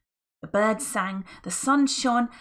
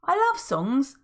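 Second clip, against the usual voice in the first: high, delighted; light up about songs; Friday, gleeful; 7 semitones higher, 4 dB louder than usual. Acted. Friday explaining his love of songs, high.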